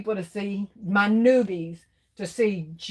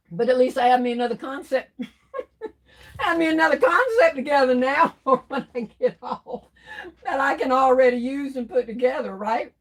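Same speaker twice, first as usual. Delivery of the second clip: laughing; 5 semitones higher than usual. Real, not acted. Laughs about her new concept.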